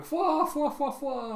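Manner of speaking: high, hooting "fwa" laughter; mock cartoon group laugh, fading; crew laughing along. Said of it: "crew all just laughing along with them".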